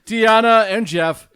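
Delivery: loud, high, ceremonious officiant voice; wedding officiant. Acted. Officiant addressing the wedding guests, high and ceremonious. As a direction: preside over the vows with pomp.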